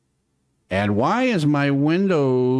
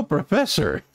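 ordinary delivery

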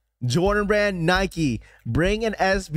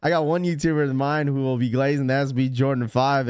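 ordinary talk, nothing marked

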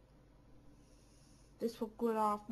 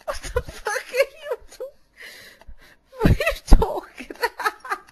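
1 outraged disbelief; 11 semitones higher, 7 dB louder than usual. Real, not acted.

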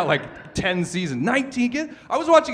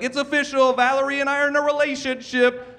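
higher, bright official announcement voice; mock proud announcement; 7 semitones higher than usual.

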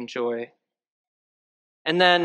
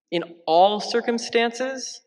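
much higher, slow disbelieving question; incredulous; 9 semitones higher, slower than usual. Acted. Priest questioning Saint Paul's words, incredulous. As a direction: echo it as if it can't be true.

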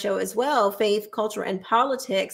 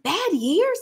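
incredulous echo; 8 semitones higher than usual. Real, not acted. Incredulous echo of friend's phrase.

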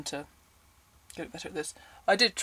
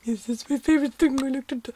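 stupid Victoria voice; 9 semitones higher than usual. Pretend. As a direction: play the sweet innocent heroine, hammed up. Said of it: "talking in this stupid voice".

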